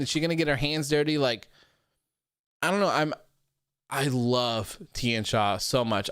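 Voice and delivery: ordinary delivery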